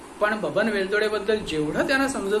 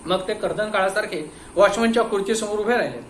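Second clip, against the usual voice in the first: ordinary delivery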